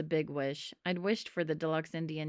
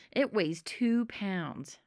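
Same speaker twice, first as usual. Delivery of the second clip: slow, weighty announcement; slow reveal; much slower than usual. Acted. Sam impressing the gang with the eyeball, slowly. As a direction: draw it out to impress. Sam boasting mysterious object.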